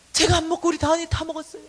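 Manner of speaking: loud whiny excuse, stressing 제가; mock excuse. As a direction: shift the blame like a caught child. (죄를 고백하며 남 핑계 대는 크고 억울한 말투)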